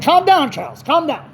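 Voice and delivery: high-pitched retold plea to calm down; imitated pacifying caller. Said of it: "He said".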